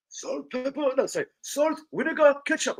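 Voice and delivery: high-pitched Turkish kebab man; mock Turkish accent. Kebab man offers condiments, high mock Turkish accent. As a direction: list it in a put-on high Turkish voice. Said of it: "Watch how he says vinegar".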